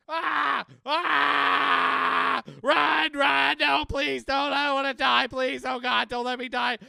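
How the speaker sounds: high, full-volume mock pleading; screaming to survive. Player begging not to die in game, screamed. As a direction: scream it like begging for your life. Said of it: "screaming to survive".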